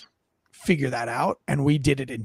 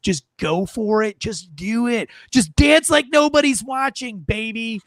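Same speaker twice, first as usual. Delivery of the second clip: high, hammed-up shout; playful high holler, easing off at the end; exuberant urging; 6 semitones higher than usual. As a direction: belt it out like a carefree pep talk. Exuberant urging to let loose.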